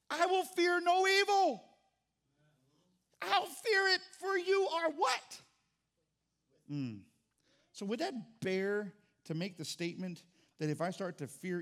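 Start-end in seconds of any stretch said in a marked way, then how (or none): 0.1-5.4 much higher, louder, slow proclamation; fiery preacher crying out; preaching shout. Acted. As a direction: proclaim it to the rafters. Preaching climax at full force.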